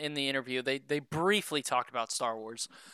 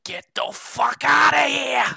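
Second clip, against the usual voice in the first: excited shout; 12 semitones higher, 10 dB louder than usual. Retold or imitated. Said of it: "I'm like".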